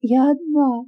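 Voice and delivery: lightly voiced sobbing girl. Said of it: "рыдая".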